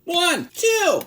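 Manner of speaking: high, loud count; calling out measures. Pretend. Calls out measures while pouring.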